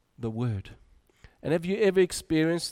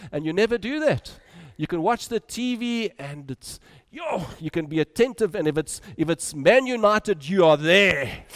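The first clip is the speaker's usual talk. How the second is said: higher, loud match-day excitement; excited; 3 semitones higher, 6 dB louder than usual. Acted. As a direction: pump it up like a fan. Football fan excitement.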